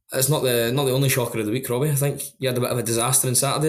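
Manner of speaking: ordinary talk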